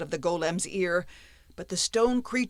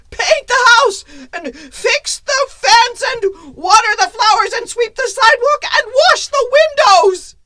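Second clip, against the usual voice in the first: much higher, loud frantic shouting of orders; panicked apprentice; 14 dB louder than usual. Acted. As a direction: scream out chores in terror.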